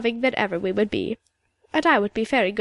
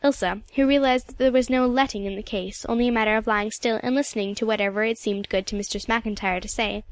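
ordinary delivery

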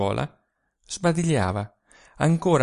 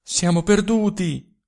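alarmed cry; 8 semitones higher, 4 dB louder than usual. A narrator's reading. Malia in alarm.

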